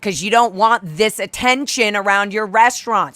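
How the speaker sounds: loud retort. Retold or imitated. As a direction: shout her down. Shouting down the owner.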